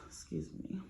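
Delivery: unvoiced "Excuse me." under her breath; a breathy whispered "Excuse me."; whispered aside. Real, not acted. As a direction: whisper an apology in passing. Aside after swearing.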